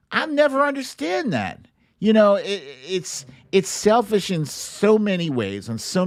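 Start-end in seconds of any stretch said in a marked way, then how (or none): none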